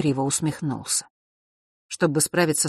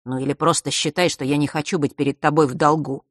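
ordinary delivery, nothing marked

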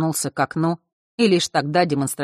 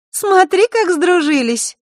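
put-on very high, loud girlish voice; loud, high, amused observation; Zakharra, teasing; 14 semitones higher, 8 dB louder, slower than usual. A narrator's reading. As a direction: tease at a high pitch, slowing at the end. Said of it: "произнесла и Захарра, едва сдерживая".

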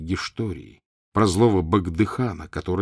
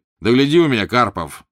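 louder stern warning; 4 semitones higher, 7 dB louder than usual. A narrator's reading. Prince warning the father not to return, stern and louder. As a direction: wag a finger with the voice. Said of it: "строго молвил".

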